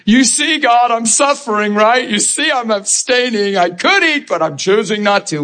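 a mock martyr's whine; mock pious whining. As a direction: show off the suffering to earn praise. Mocking pious self-pity.

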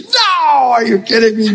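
high, drawn-out comic protest; squealed, hammed-up refusal; mock disbelief. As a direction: imitate her incredulous no. Acts out her incredulous refusal.

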